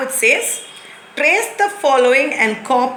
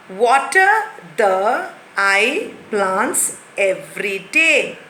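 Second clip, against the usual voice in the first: slow, word-by-word reading; slow jumbled reading; slower than usual. Shown as a demonstration. Teacher reads the jumbled words out slowly. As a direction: read the jumble carefully. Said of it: "jumbled sentence read aloud".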